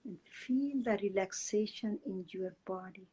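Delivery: soft, unhurried meditation guide voice; guided meditation. Acted. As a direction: ease the listeners into calm breathing.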